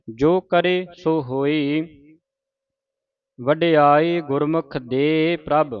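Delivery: slow, stop on ਪ੍ਰਭ; pause after ਪ੍ਰਭ. Shown as a demonstration. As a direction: hold at ਪ੍ਰਭ. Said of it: "ਪ੍ਰਭ ਤੇ ਆਣ ਕੇ ਰੁਕਣਾ".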